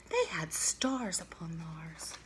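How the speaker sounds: slow, soft, almost whispered; hushed wonder. A narrator's reading. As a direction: let the amazement hush you. Awed reveal of stars.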